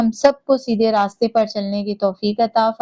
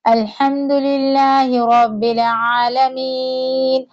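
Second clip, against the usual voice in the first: slow, drawn-out melodic tajweed recitation; Quran recitation; much slower than usual. Shown as a demonstration. Teacher reciting Surah Fatiha for students to follow, slow melodic chant. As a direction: chant each verse slowly with long held vowels.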